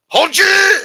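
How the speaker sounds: very high, loud barked mock Chinese accent; hammed-up Chinese-accent yell; mock Chinese soldier. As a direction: shout orders in a crude Chinese-accent impression. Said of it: "how they talk when they're fucking angry".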